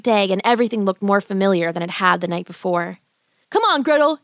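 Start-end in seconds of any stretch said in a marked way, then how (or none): 3.5-4.2 higher-pitched call to his sister; mild boyish yell. Acted. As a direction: call her along urgently. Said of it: "Hansel yelled".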